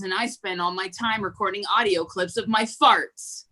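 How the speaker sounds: ordinary delivery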